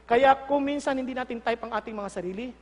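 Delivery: normal talking voice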